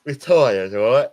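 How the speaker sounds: playful, loud exaggeration; mock tragic, tongue in cheek; joking exaggeration. Joking exaggeration about being wasted.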